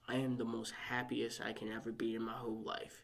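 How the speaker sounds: soft unvoiced whisper. Real, not acted. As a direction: breathe it out as a secret. Whispered confession of happiness.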